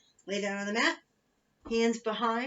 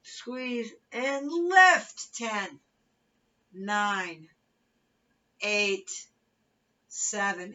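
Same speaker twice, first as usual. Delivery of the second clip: aerobics instructor; 8 dB louder, much slower than usual. Acted. Fitness cueing and countdown.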